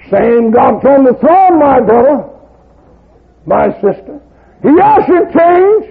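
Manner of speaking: preacher telling brothers and sisters, loud preaching; preaching at full force. Acted. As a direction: drive it home to the flock. Preacher proclaims to congregation.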